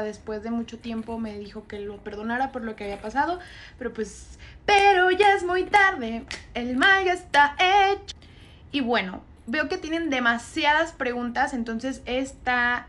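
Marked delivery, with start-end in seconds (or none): 4.7-8.1 higher, louder and slow, every word weighed; emphatic verdict. Real, not acted. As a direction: lay down the final judgment.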